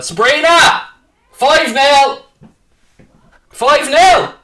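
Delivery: triumphant screaming at his partner. Real, not acted. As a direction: yell the score across the house. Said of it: "why I'm screaming five nil".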